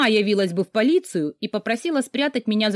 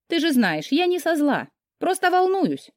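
high-pitched, pleading self-defence; reproachful concern; 8 semitones higher than usual. A narrator's reading. Friend excusing her nagging as worry, reproachful concern. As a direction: justify yourself in a raised pitch. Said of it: "Карина укоризненно вздохнула".

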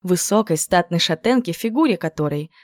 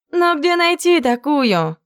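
slow, higher drawl of найти; drawn-out; 10 semitones higher, slower than usual. Acted. Clover drawling her doubt to Jen. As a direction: drag the words out plaintively. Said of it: "протянула Кловер".

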